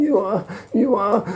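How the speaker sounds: high, squealed submission; mock girlish surrender. Troll answering the man pounding him, mock squealing. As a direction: imitate a pinned victim giving in. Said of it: "sounds like a little girl".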